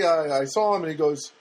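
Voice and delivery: ordinary talk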